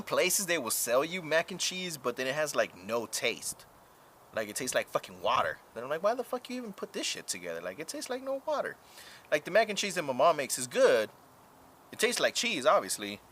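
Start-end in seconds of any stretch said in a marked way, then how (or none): 6.0-8.8 indignant complaint. Retold or imitated. Complaining about tasteless mac.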